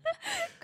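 high burst of laughter. Real, not acted. Laughs at joke.

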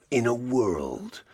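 portentous movie voiceover; cinema trailer voice. Acted. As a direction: draw it out like an American film trailer. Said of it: "they'd always start".

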